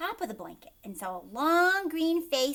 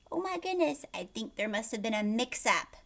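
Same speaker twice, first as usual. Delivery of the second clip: usual speaking voice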